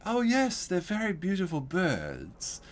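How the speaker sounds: high, slow, theatrical greeting; flamboyant old neighbor. Retold or imitated. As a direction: drawl it out like a flamboyant old man. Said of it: "the way the guy said it was so flamboyant".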